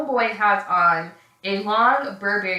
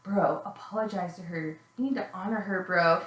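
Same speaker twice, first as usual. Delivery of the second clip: imitated burnout bro; 2 semitones lower, 7 dB quieter than usual. Burnout friend steps in for girlfriend, mellow mock-bro voice. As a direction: play a mellow burnout, barely any volume. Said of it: "one of Michael's burnout friends".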